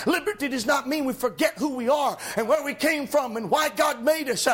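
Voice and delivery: rising, shouted gospel preaching; preaching at full force. Acted. Preacher to his congregation, shouting, fervent. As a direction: lift the congregation with fiery, shouting delivery. Preacher rises again on liberty.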